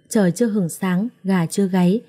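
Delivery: ordinary delivery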